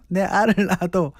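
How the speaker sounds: lightly put-on Gyeongsang dialect, tossed off; Gyeongsang accent. DJ retelling an old comedy bit, mock Gyeongsang accent. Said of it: "뭐라고요?".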